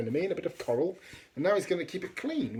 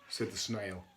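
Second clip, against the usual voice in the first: usual speaking voice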